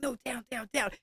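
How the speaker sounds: very soft, hushed telling-off; quiet mock command to an animal; imitated background command. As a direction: shoo a tiger away under your breath. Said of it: "you can hear him be like".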